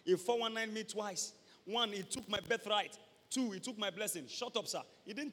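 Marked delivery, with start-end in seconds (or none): none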